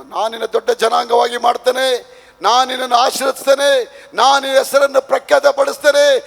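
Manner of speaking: high, shouted declamation of scripture; full-force preaching. Real, not acted. Preacher declaiming God's promise to Abraham, full-force preaching.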